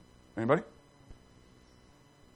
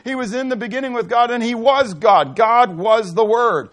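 loud, high preacher's declaration; booming pulpit scripture; fervent preaching; 7 semitones higher, 8 dB louder than usual. Acted. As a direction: proclaim it to the whole room. Preaching scripture at full force.

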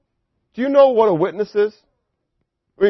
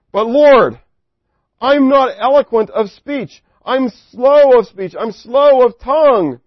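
higher, louder, slow pleading as Moses; 6 semitones higher, 6 dB louder, slower than usual. Acted. Preacher voicing Moses begging God, slow higher plea. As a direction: drag out the excuses like a reluctant man.

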